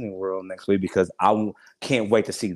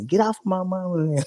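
high child voice, a playful mock protest; be his little kids shooing him off mama; kids' protest; 10 semitones higher, 3 dB louder than usual. Voicing his kids jumping him.

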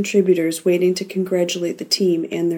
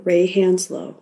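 ordinary delivery, nothing marked

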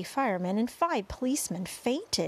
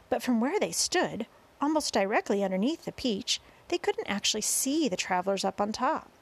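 ordinary delivery

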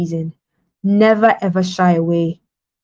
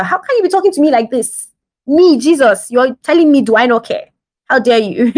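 higher-pitched mock outrage; indignant; 3 semitones higher than usual. Jesus as he might have scolded Martha, mock indignant. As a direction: act hugely affronted at being spoken to so. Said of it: "he didn't even say to Mar-, Martha".

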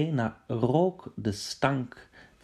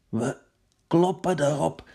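firm call; 4 semitones higher than usual. Acted. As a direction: rouse them for the attack. Same words louder.

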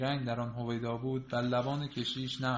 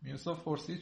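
ordinary delivery

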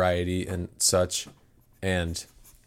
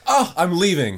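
higher, louder huff; mock offended; 9 semitones higher, 6 dB louder than usual. [name] walks out after Todd's remark, mock offended. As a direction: announce the exit in mock offence.

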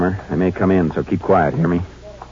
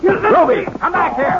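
urgent call. Acted. Ruby runs for the stove.

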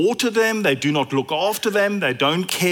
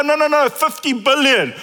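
insisting; 6 semitones higher, 4 dB louder than usual. Retold or imitated. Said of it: "And you go".

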